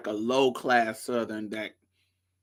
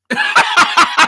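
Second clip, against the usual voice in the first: very high, loud cackle; hard laughter; 10 dB louder than usual. Real, not acted. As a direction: explode into shrieking-high laughter. Laughing at the realisation.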